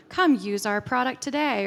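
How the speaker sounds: hammed-up advertising line; advertorial voice. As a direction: pitch it like a pushy ad slogan. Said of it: "speaking in a really advertorial voice".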